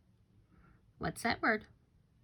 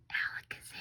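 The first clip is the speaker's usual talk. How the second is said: a soft, secret whisper of the spell; 9 dB quieter, much faster than usual. Shown as a demonstration. Teacher whispering the spell to build suspense. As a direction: breathe the magic word like a secret.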